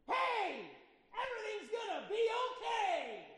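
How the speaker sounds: high, shouted reassurance that misfires; comic loud comfort. Shown as a demonstration. Preacher shouting comfort at a hurting friend, comically loud. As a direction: yell the comfort in their face. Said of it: "It doesn't quite work out the way".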